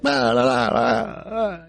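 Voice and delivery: high singsong blah-blah standing in for Italian; mock Italian chatter. Husband keeps speaking Italian to his wife, high mocking babble. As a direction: babble up high, imitating someone rattling on in Italian. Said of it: "He keeps speaking Italian to her".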